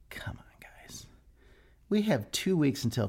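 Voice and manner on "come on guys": whisper. Real, not acted. Groaning at friends' troll text.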